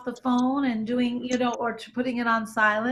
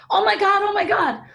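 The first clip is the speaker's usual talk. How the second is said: much higher, loud mock panic; panicked; 9 semitones higher, 7 dB louder, faster than usual. Shown as a demonstration. Showing listeners how panic would sound, frantic. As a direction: demo of freaking out, pitched way up. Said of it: "if I had started going like this".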